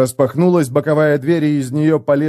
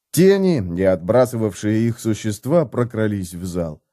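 usual speaking voice

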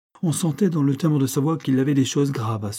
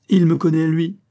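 blurted outburst; 6 semitones higher, 6 dB louder than usual. A narrator's reading. Husband blurts reason.

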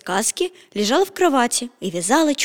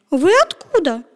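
put-on curious girl; 7 semitones higher, 3 dB louder than usual. A narrator's reading. Girl asks Petya.

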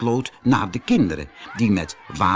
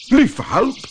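high startled exclamation, a touch louder; surprised cry; 12 semitones higher, 5 dB louder than usual. Acted. Professor startled as his jacket lifts him, high surprised cry. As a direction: gasp it out in alarm, pitched up. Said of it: "riep professor Potlood".

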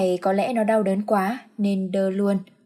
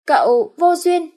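teasing retort; 9 semitones higher, 7 dB louder, slower than usual. Acted. Servant teases master.

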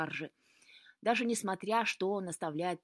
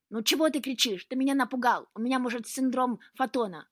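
loud, higher-pitched complaint; indignant startled cat; 5 semitones higher, 8 dB louder than usual. A narrator's reading. Startled cat to Luka who shouted, indignant. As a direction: scold the boy who made you jump.

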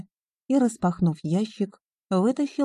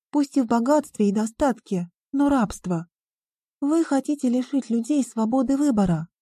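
put-on higher voice of a dismayed girl; protesting young heroine; 6 semitones higher than usual. A narrator's reading. Риона accuses the businessman of taking away choice, protesting. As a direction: protest in the girl's raised pitch.

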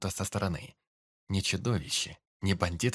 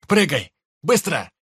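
urgent shout; 7 semitones higher, 13 dB louder than usual. Acted. Urging brother to jump.

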